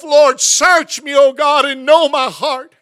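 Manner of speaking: slow, loud cry of search me; loud prayer. Retold or imitated. Preacher retelling his own prayer loudly. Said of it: "I've even prayed myself".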